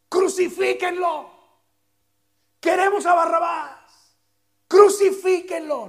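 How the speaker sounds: high, loud, slow mob demand; bay for his death like a furious mob; angry mob shout. Acted. The same crowd demanding Jesus be crucified, a loud angry shout.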